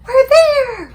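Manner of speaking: a thrilled exclamation; excited whisper. A narrator's reading. Sophie telling the giant they've arrived, excited. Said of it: "Sophie whispered excitedly".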